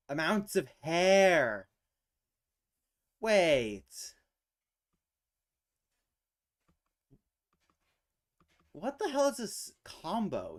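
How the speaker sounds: loud, high disbelief; exasperated. Real, not acted. Arguing with the puzzle after losing a guess: loud exasperation. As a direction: shout at the puzzle in frustration.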